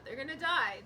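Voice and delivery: exasperated. Real, not acted. Gamer despairing at characters. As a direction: blurt it in frustration.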